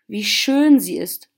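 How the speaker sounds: ordinary delivery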